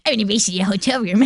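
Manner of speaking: put-on fast, teasing childish challenge; quick mock-childish taunt; childish dare. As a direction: say it like a kid daring a friend. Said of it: "the next minute you're like".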